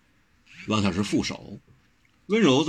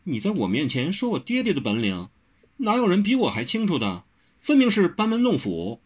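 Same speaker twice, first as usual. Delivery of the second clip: teasing, with a smile; 8 semitones higher than usual. A narrator's reading. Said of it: "抿嘴笑道".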